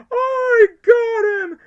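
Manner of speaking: shrieking, drawn-out triumph; Riddler and Grinch. Retold or imitated. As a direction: scream it very high, stretching each word. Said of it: "mismatch of the Riddler and the Grinch".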